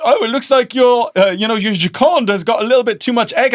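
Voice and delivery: loud, high mock-host chatter; teasing show host. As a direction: imitate a presenter ribbing a contestant. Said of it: "came over to me and they're like".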